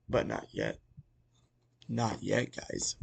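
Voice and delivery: soft, held-back "not yet"; hushed teaser. Real, not acted. Host teasing viewers about what is coming, hushed. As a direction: drop it low and soft to tease.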